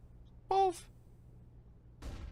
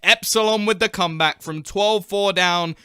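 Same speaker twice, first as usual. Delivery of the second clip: louder, higher rising commentary; excited play-by-play; 6 semitones higher, 9 dB louder than usual. Acted. Caster calling the comeback win, excited and loud. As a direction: call the round's finish, building hype. Climax of live casting.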